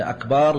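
أكبر said as "akbaar", long final vowel; lengthened ba. Shown as a demonstration. Teacher explaining the wrong word, أكبر with stretched last syllable. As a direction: hold the second vowel of أكبر. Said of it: "ألا يمد الباء".

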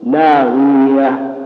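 لاغية dragged very slowly, i swelling into a long ee; exaggerated over-lengthening. Shown as a demonstration. Teacher showing the forbidden extra letter, hugely stretched لاغية. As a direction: stretch لاغية until an extra yaa appears. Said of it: "بحيث أنها تتولد منها".

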